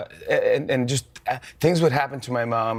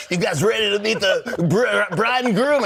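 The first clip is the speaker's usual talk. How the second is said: much higher, slow hype-man announcement; wedding DJ; 9 semitones higher, 3 dB louder, slower than usual. Retold or imitated. Retelling the wedding, the DJ announcing the couple, high and hyped. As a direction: ham it up like a DJ working the room. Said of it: "the D J could be like".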